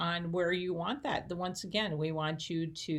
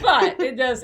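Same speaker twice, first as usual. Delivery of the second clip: laughing; 8 semitones higher, 14 dB louder than usual. Real, not acted. Laughs while agreeing.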